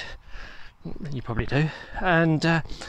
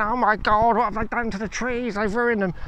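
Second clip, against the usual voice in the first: higher, mock-outraged passer-by; mock complaint; 5 semitones higher than usual. Vlogger mimicking townsfolk upset about pruned trees, mock outrage. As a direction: imitate people fussing over the trees. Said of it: "everyone complains, going".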